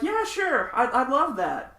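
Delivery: high-pitched, lightly acted eager yes; eager agreement. Stranger to band offering prayer, eagerly agreeing. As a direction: retell a gladly agreeing stranger, voice lifted.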